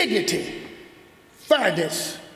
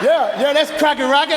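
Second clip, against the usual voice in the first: excited fan; 4 semitones higher, 4 dB louder than usual. Retold or imitated. Fan calling out his nickname.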